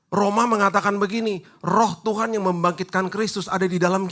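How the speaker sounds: high, loud sermon delivery; fiery pastor pitch; full-force preaching. Acted. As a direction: drive the point home to the congregation.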